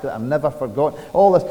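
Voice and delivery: usual speaking voice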